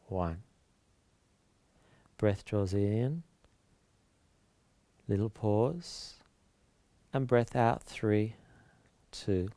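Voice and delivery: calm breathing-count voice with long pauses; soft, measured yoga meditation delivery; guided relaxation. Real, not acted.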